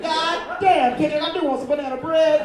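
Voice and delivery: a delighted fan exclaiming; excited fan. Pretend. Listener reacts to [name]'s recipe rap, loud and delighted. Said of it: "You'd be like".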